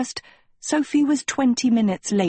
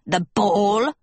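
screeching. A narrator's reading. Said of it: "Hester screeched".

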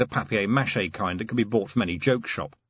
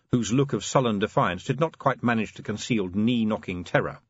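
plain reading voice

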